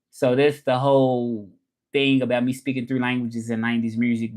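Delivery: normal talking voice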